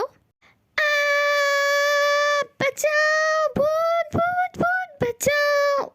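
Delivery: very high, drawn-out scream for help; terrified scream. Acted. Newcomer seeing a ghost and calling for help, screaming in terror. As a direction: shriek each word in panic. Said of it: "ज़्यादा मत चीखो".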